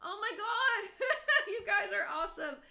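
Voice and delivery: high, teary laugh, voice close to crying; emotional, laughing through tears. Real, not acted. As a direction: overcome with gratitude, laughing and tearing up. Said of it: "it makes me a little emotional".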